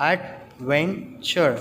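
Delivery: slow "adventure" in three separate beats; syllable by syllable. Shown as a demonstration. Teacher counting syllables for children, "adventure" slowly split in three. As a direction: break "adventure" into ad, ven, ture with pauses. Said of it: "three syllables".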